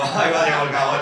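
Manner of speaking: mock office taunt; mocking coworkers. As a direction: jeer at the guy going to the loo. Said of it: "te señalaba a todo el mundo como".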